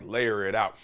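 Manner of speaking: normal talking voice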